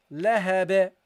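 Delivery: "Lahab" with the final b released in a bounce; measured "Lahab" with an echoed ending; bounced final ba. Shown as a demonstration. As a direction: say "Lahab" deliberately and bounce its last b. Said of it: "You give it a bounce".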